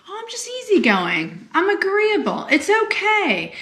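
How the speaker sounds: high, airy people-pleasing voice; easygoing people-pleaser. Retold or imitated. People-pleaser claiming to be easygoing, high and agreeable.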